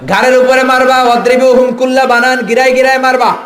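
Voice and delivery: high, loud sermon cry; thundering preaching. Real, not acted. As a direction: thunder the command at the crowd.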